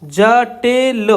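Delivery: जटिल stretched very slowly; drawn out while writing. Shown as a demonstration. Teacher tracing the outline aloud, very slowly. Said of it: "देखिए कैसे बनाएंगे".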